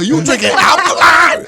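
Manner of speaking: laughing mock disbelief. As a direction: tease him through laughter. Roasting his alkaline water.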